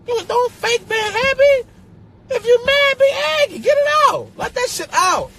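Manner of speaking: high, loud, insistent outburst; exasperated yelling-style rant; worked-up venting. Real, not acted. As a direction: push it out high and forceful. Urging people to vent anger.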